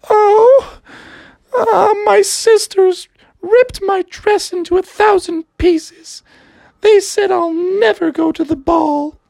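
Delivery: high, breathy-free lilting princess. Acted. Princess telling Froggy about her sisters, high voice. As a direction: play the upset princess in a high voice. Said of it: "the princess was like".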